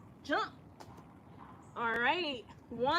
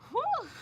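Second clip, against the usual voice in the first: whew; 12 semitones higher than usual. Retold or imitated. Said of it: "I was like".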